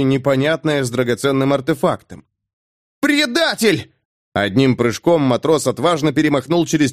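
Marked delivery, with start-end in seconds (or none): 3.0-3.9 high furious cry; furious shout. Acted. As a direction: hurl the accusation. Sailor sees the betrayal.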